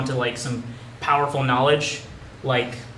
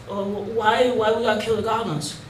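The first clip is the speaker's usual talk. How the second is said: put-on curious kid; 5 semitones higher, 4 dB quieter than usual. Kid player asks game master.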